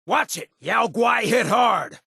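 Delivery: high, slow yelled warning; battle warning shout. Acted. Companion warning his partner about a bear mid-fight, high shouted call. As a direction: call out the danger across the fight.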